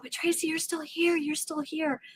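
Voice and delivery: soft, high, tender reassurance; heart's gentle voice. Retold or imitated. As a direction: gently console yourself. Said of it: "my heart's going".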